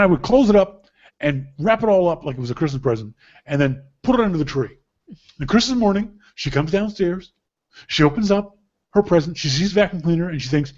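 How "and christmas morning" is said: ordinary talk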